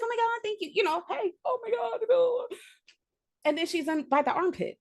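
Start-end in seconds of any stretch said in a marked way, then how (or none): 0.0-0.7 high, soft gushing thanks. Retold or imitated. 1.1-2.5 high, soft squealing greeting; excited greeting. Retold or imitated.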